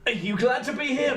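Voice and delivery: unsure human humouring a robot; Alison. Acted. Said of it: "She has no idea how to react to this robot dog".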